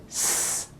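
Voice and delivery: S as a voiceless hiss; S held as sharp air with no buzz. Shown as a demonstration. Teacher contrasts end-of-syllable sound, S hissed sharp and voiceless. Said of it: "the sharp S".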